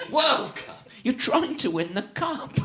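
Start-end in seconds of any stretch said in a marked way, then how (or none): 0.1-0.9 World Cup, World raised and leaned on through a laugh; stress on World. Retold or imitated. Mocking American stress to friends, World leaned on. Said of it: "Why are you stressing the modifier".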